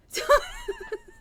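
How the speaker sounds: loud squealing laugh; shrill delighted laugh; high shrieking laugh. Real, not acted. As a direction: burst into a high giggle. Laughing at chat.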